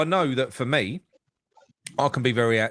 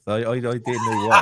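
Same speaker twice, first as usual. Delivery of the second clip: lightly done Midlands accent impression; a hint of a Midlands manager's accent; Potter's Midlands accent; 4 dB louder than usual. Mocking the manager's way of talking, in a mild Midlands accent. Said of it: "he used to speak like this".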